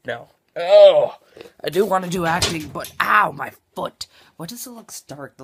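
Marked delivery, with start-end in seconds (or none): 0.6-4.1 pained yelp. Real, not acted. Hurts foot mid-sentence.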